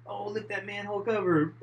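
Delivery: high, lightly hammed skit kid; cool kid. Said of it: "He was all cool".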